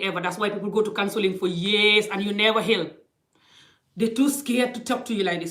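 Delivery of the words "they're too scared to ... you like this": ordinary delivery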